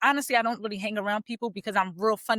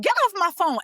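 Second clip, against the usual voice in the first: mock annoyed sisters; 9 semitones higher, 5 dB louder than usual. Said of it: "They was like".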